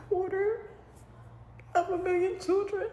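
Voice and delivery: high, cracking voice close to tears; words catch as the tears come; real tearful break. Real, not acted. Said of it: "I'm trying not to cry".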